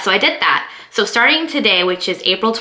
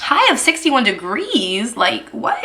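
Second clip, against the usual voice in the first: surprised disbelief; 3 semitones higher, slower than usual. Real, not acted. Surprised at the forecast.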